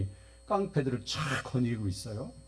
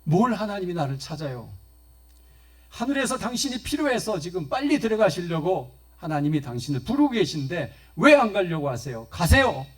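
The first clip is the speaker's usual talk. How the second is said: loud, higher scolding that builds to a shout; 5 semitones higher, 6 dB louder than usual. Acted. 권사님을 꾸짖는 목사님, 점점 커지는 호통. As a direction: scold her hard, then bark the last word. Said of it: "목사님의 야단치는 소리에".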